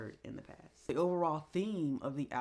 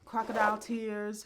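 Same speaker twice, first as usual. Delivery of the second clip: normal talking voice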